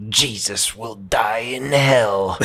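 whispered, slow and creepy; slow whispered demon threat; slow demonic whisper. Retold or imitated. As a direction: draw it out in a possessed whisper. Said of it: "And he goes".

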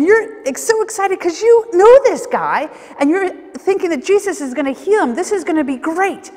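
high, loud burst of excitement; excited onlooker. Acted. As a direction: act out eager excitement. Acting out the excited crowd.

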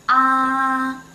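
AH held long, high, level and loud; high level first tone. Shown as a demonstration. Teacher showing first tone like at the doctor's, high level Ah. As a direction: sing out Ah on one steady high pitch. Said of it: "so that's very high".

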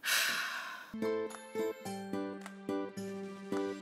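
long, soft exhale; airy, quiet sigh of dismay. Real, not acted. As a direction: let out a drawn-out sigh. Dismayed at atrocious waffle edges.

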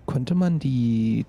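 normal talking voice

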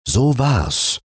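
ordinary delivery, nothing marked

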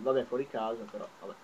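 usual speaking voice

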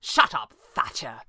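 curt, understated snap; snapped. A narrator's reading. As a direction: snap as if at a dog. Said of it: "Pavli snapped, as one might do to a dog".

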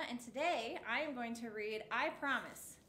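usual speaking voice

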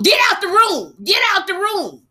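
high, loud bossy yell; imitated yelling. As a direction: bark the order out twice. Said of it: "What I remember is you going".

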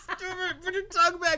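high, squeaky, mocking scold. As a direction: squeal it like a cartoon. Mocking the actor's tongue.

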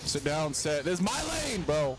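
high-pitched trash-talk yell. Real, not acted. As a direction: yell it at a rival muscling into your lane. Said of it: "I gotta stop yelling".

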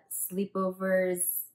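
ordinary delivery

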